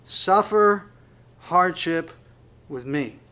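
word by word, pausing after SUFFER and HARDSHIP; deliberate, spaced-out scripture reading; slowed right down. Real, not acted. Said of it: "I just want to slow down at this point".

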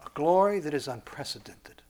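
soft, measured divine declaration; voice of God. Acted. God declaring man's special glory, hushed and solemn. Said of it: "And God says".

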